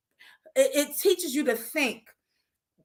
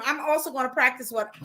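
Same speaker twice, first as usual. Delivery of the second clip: ordinary talk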